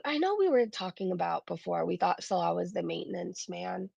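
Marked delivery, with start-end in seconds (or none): none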